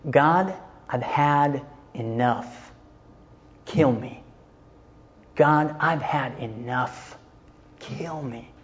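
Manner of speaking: slow, weary, despairing; despairing plea. Retold or imitated. Preacher voicing a despairing prophet, slow and weary. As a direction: voice a man begging God for release, slow and weary. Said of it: "Not, "I've had enough".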